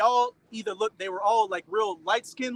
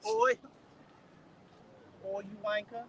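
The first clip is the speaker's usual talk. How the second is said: mock Australian accent, trailing off softly; a quick jokey Australian "Oi"; 9 dB quieter than usual. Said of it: "accent, man".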